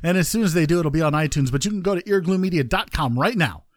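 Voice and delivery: usual speaking voice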